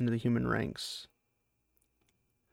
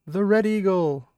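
war cry; 7 semitones higher, 5 dB louder than usual. Acted. War cry during charge.

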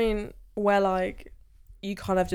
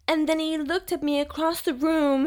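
mock-dramatic romance narrator, pitched up; cheesy audiobook narration; 8 semitones higher than usual. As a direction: ham it up like an overacted audiobook. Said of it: "It's just, like, so cheesy".

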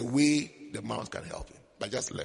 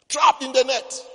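dramatic; 8 semitones higher, 8 dB louder than usual. Acted. Dramatic emphasis on lion's plight.

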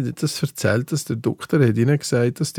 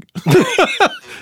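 bursts out in a high laugh; loud high laugh; 3 dB louder than usual. Real, not acted. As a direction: cracks up loudly at his own story. Laughing at own story.

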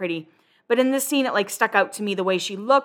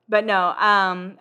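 ordinary delivery

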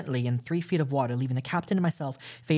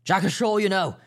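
higher and louder quip; brisk quip; 6 semitones higher, 6 dB louder than usual. A narrator's reading. Captain joking about the pirates, brisk and raised. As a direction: toss it off with grim cheer.

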